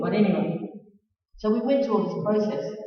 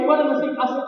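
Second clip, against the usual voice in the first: usual speaking voice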